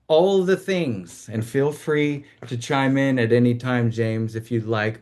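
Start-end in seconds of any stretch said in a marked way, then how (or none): 0.1-1.3 "All the Things" drawn up high; segment title announcement. Real, not acted.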